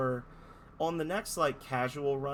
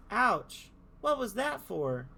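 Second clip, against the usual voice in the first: character voice; 8 semitones higher, much slower than usual. Acted. Voicing hurt character, reading dialogue.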